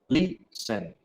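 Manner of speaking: LISTEN in two parts, voice up on LI, down on SEN; first-syllable stress. Shown as a demonstration. Teacher answers where the accent falls, LISTEN stressed on LI. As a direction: stretch and stress LI in LISTEN, let SEN fall. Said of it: "Ahí subo la voz y bajo".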